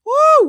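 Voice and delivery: high, loud "woo"; loud exasperated whoop. Real, not acted. Venting, calls for an ally.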